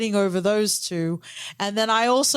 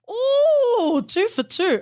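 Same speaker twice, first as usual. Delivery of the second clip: excited ooh; 13 semitones higher, 3 dB louder, much slower than usual. Real, not acted. Reacting to matching rankings.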